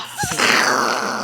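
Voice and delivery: burst of laughter. Real, not acted. Flubbed intro, bursts out laughing.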